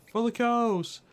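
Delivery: loud high battle cry; shouting. Pretend. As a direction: yell it like a rallying cry. Rallying cry for the cows.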